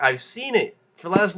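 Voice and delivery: ordinary talk, nothing marked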